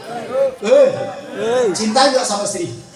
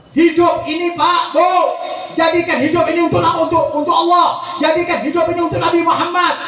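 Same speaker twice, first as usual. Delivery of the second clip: insistent, raised preacher's cadence; impassioned preaching; 5 semitones higher than usual. Acted. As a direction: drive each phrase home, drawing out the last. Preaches at full force. (khotbah berapi-api, penuh penekanan)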